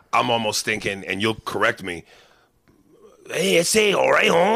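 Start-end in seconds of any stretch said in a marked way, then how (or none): 3.3-4.6 high-pitched mock Mexican homie accent. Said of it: "when you say stereotypical".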